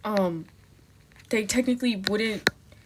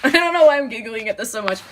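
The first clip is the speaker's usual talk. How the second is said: louder, quicker, laughing through it; giggling; 7 dB louder, faster than usual. Real, not acted. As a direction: giggle helplessly as you talk.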